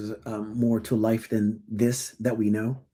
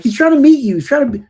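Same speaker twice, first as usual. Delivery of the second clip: higher, louder, pleading; exasperated higher plea; 13 semitones higher, 9 dB louder, faster than usual. Retold or imitated. Mom to the stranger, exasperated and louder. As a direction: mom pushing back, hard. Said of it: "And I'd be like".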